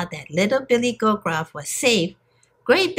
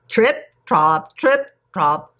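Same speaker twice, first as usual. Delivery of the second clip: heavy hoofbeats; 3 dB louder, slower than usual. A narrator's reading. Great big goat crosses the bridge, heavy hoofbeat chant.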